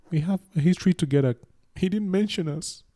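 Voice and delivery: high-pitched, playful complaint; mock protest. Cameroonian jokingly objecting to being left out of Nigeria's history, mock protest.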